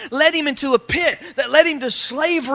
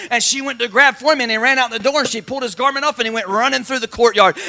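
normal talking voice

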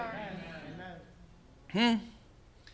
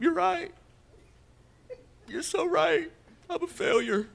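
much higher whiny voice; mock self-pity; 10 semitones higher than usual. Preacher voicing a believer agreeing with the devil, high mock whine. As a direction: whimper it high in hammed-up defeat.

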